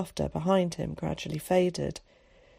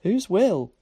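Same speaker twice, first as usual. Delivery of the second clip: blunt; 3 semitones higher, 5 dB louder than usual. A narrator's reading. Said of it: "asked Tom bluntly".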